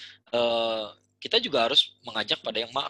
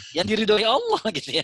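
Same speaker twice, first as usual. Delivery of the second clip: higher, louder, laughing through the words; laughing punchline; 9 semitones higher, 5 dB louder than usual. Real, not acted. Cleric laughing as he lands the point to host, higher and louder. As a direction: deliver it with a laugh.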